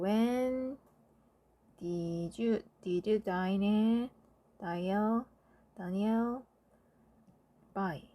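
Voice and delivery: slow dictation. Shown as a demonstration. Teacher dictating answer slowly.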